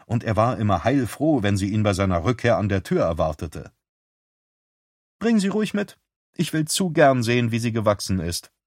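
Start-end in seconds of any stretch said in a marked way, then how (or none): 5.2-8.5 higher, brighter woman's voice; lightly voiced sister on the phone; Beth's voice.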